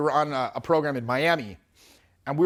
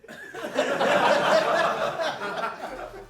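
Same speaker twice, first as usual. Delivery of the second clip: high, cackling laugh; 10 semitones higher than usual. Retold or imitated. Surgeon friend laughing in story.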